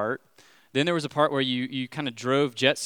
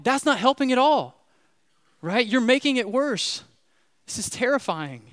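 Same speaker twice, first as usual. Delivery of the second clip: panicked; 11 semitones higher, 6 dB louder than usual. Retold or imitated. Panicked reply to yelling guide.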